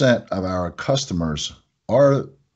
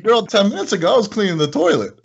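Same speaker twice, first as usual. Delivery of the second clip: higher, playful sass; sassy reply; 9 semitones higher, faster than usual. Retold or imitated. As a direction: tease her, pitched up. His own sassy reply.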